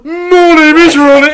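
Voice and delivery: loud, high, stretched mock accent from Southampton; shouted Southampton mimicry. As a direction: holler it in broad Southampton, close to laughing. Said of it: "I would shout".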